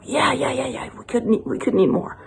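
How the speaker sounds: soft, lightly voiced eager couple; hushed, mildly sheepish yeses. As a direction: agree quickly in a small, quiet voice. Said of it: "And they go".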